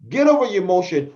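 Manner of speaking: usual speaking voice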